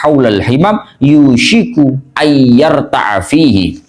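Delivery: slow, measured recitation of Arabic; Arabic text recitation. Acted. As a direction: read out the Arabic scripture slowly, drawing out phrases. Reads the Arabic text aloud.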